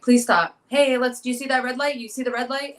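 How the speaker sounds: high, urgent pleading; frightened plea from the passenger seat. Retold or imitated. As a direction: beg him to stop the car. Begging him to stop at red light.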